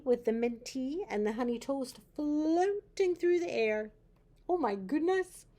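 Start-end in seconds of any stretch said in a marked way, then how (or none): 2.2-3.9 higher, louder and slow; wondering. A narrator's reading. Reading ghost story to child. 4.5-5.5 higher, louder surprised gasp. Real, not acted. Reacts to floating tray.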